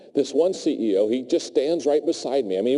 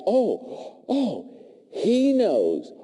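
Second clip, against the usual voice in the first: relieved staff; 8 semitones higher, much slower than usual. Retold or imitated. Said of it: "It would be like".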